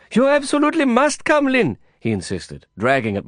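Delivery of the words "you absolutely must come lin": high, pleading Indian friend voice; Prabhakar's high excited plea; urgent Prabhakar. Acted. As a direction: beg him to hurry, pitched way up. Prabhakar insisting.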